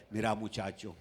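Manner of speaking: low, soft doctor voice. Acted. Doctor giving the patient bad news, low and soft. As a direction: break it to the patient gently and low. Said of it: "así me va a decir".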